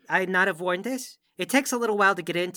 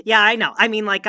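laughing it off, louder; nervous laugh; 2 semitones higher, 8 dB louder than usual. Acted. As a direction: giggle through the embarrassment. Starstruck recruit laughs nervously.

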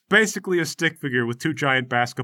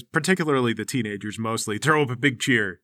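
ordinary talk, nothing marked

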